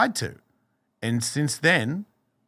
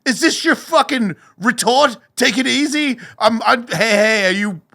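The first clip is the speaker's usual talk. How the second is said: high, loud, incredulous rant; angry outburst; 12 semitones higher, 9 dB louder than usual. Retold or imitated. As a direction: throw it back in disbelief, shouting. Retold furious rant at God.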